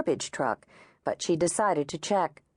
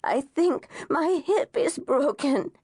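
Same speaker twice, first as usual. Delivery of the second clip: higher, slow, pained moan; 8 semitones higher, slower than usual. A narrator's reading. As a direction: moan it through the pain.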